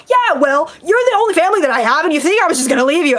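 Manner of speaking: high, fast, heated retort; bickering shout. Acted. Brother shouting back at his sister, high and fast. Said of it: "shouting over each other".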